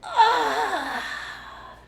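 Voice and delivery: very high, loud gasp. A narrator's reading. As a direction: gasp from the burn. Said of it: "gasped the smallest fox".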